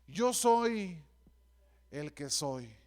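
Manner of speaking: weighty, with a long pause, dropping low at the end; God speaking, measured and solemn; solemn divine declaration. Acted. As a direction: declare it gravely, sinking on the second half. God's name quoted solemnly, with pauses.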